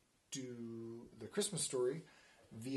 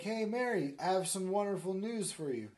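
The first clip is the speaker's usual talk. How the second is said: lightly voiced angel, higher and louder; higher, projected herald delivery; announcing angel; 6 semitones higher, 6 dB louder than usual. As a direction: hail her with big news. Acted angel's announcement in story.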